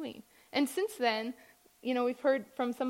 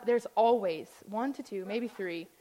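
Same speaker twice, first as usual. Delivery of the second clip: ordinary talk, nothing marked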